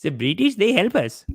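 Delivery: a hint of a put-on Indian accent; mocking imitation with a touch of accent; mock inner voice. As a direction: lightly parody his inner colonial voice. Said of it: "some voice in his head that's like".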